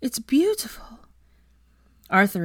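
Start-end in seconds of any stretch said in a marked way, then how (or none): 0.0-1.0 hushed, gasping whisper; breathless whisper. Acted. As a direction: breathe it out in wonder. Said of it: "she gasped".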